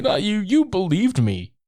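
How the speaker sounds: high, drawn-out hurt plea; hammed-up wounded disbelief; mock sad. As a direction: imitate someone sadly betrayed. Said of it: "He's, like, sad about it".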